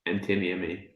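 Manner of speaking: a quiet, mumbled Scottish accent. Shown as a demonstration. Friend asks him for his Scottish accent, quiet half-hearted Scottish accent. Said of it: "say Antti Niemi in your Scottish accent".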